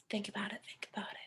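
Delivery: hushed, breathy prompt to think; whisper. Acted. Teacher urging students to ponder, whispered. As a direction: whisper it like a secret nudge.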